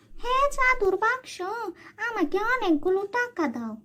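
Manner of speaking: high, greedy call to the box; aunt alone at night demanding money, high and greedy. Acted. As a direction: summon the box, high and grasping. Aunt commands the magic box.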